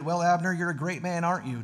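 lightly acted jeer, easing off at the end; taunting. A narrator's reading. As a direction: needle him with mock praise. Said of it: "David taunted".

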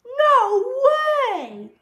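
very high, loud, stretched refusal; crying out in refusal. Acted. Froggy refusing Mom's play date, high loud cry. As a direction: shout it high in protest. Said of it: "cried Froggy".

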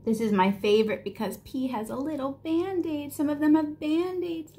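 plain reading voice

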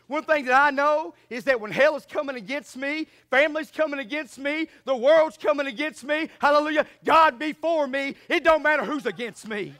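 loud building preacher cadence; rising fiery sermon; full-force preaching. Real, not acted.

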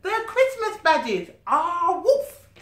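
high, loud joyful announcement; excited reveal. Acted. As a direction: present the badges with glee. Dougie's excited presentation.

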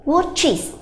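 teacher modeling the iz ending of "watches". Shown as a demonstration. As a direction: stress the extra syllable in "watches". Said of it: "không chỉ là ch mà chúng nó sẽ là".

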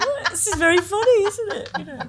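high, loud laughing delight, half mocking; amused laughing. Reading her own awkward column, laughing high and loud. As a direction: laugh it off as a great joke.

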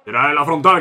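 normal talking voice

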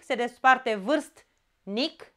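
vârstnic split slowly into syllables. Shown as a demonstration. Teacher warning pupils, slow vârstnic split. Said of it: "Atenție la vârstnic".

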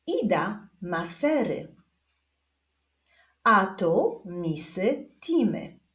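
slow, word-by-word reading; unhurried, spaced-out read-along; reading together. Shown as a demonstration. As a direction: lead learners through it slowly, pausing after each sentence. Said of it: "przeczytajmy wspólnie wszystkie zdania".

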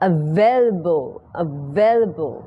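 "available" very slowly with a clear v; V-sound pronunciation. Shown as a demonstration. Teacher leading pronunciation practice, slow clear V. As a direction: stretch "available", pressing on the v syllable. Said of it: "เป็นเสียง V นะ".

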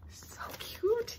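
high, whispery little squeal; delighted whisper. Real, not acted. Collector admiring little ornaments, delighted whisper.

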